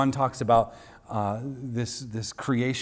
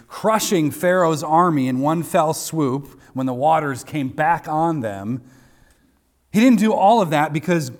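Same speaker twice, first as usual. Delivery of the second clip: louder, rising sermon push building to a peak; fiery pulpit climax, louder; building preaching; 2 semitones higher, 5 dB louder than usual. Acted. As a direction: preach it harder line by line. Preaching at full force.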